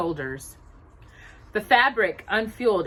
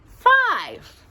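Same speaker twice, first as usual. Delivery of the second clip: high answer; 13 semitones higher, 8 dB louder than usual. Acted. Child answering in mouse game.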